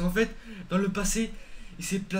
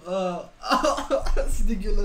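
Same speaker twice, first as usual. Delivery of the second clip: louder, laughing "c'est dégueulasse"; laughing disgust; 6 dB louder than usual. Real, not acted. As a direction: spit out the disgust. Les deux trouvent ça dégoûtant. (dégoût en riant)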